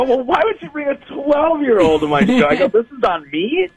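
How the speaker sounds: high-pitched, incredulous mock protest; acted-out disbelief, pitched well up; indignant comeback. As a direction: throw the blame back, voice climbing high. Comic argues back in retold quarrel.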